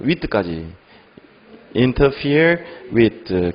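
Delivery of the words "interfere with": slow word reading. Shown as a demonstration. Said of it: "그리고 방해하다".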